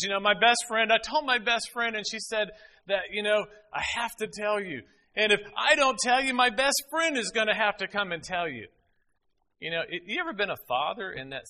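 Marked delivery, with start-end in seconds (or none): 0.0-8.7 imitating daughter. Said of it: "and she says".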